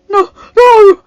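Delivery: loud, high, choking sob of no; choked cry. A narrator's reading. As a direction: choke the words out, crying. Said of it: "he choked out".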